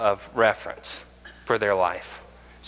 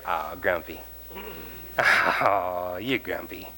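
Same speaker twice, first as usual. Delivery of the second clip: soft, teasing grumpy; mock grumpy label; 4 semitones lower, 4 dB quieter, much slower than usual. Shown as a demonstration. Teaching her girls, teasing label. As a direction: dismiss them gently with a tease. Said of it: "in your brain just go".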